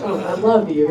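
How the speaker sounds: ordinary talk, nothing marked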